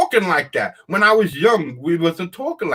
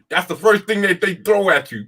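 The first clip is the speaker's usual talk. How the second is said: normal talking voice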